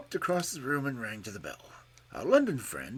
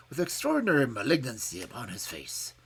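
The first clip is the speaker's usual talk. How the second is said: plain reading voice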